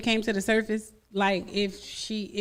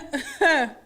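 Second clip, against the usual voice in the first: laugh; 3 semitones higher than usual. Real, not acted. Laughing at haunting threat.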